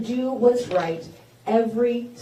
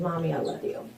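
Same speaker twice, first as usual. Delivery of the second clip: soft, hushed retelling of her son; much softer, a hint of a child's tenderness; son's tender words; 7 semitones lower, 8 dB quieter than usual. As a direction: quietly voice the boy's comfort. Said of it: "he squeezed me and he said".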